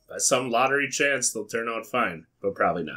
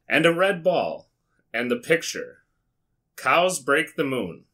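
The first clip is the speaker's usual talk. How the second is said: storybook reading; 4 semitones higher than usual. Acted. Reads the translated book aloud.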